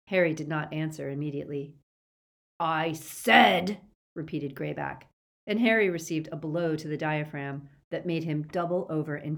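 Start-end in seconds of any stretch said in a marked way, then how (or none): 2.6-3.8 loud, threatening repeat; loud demand. Acted. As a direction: lean in hard on it.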